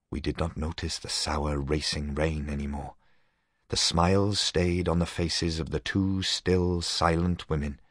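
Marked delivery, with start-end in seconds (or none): none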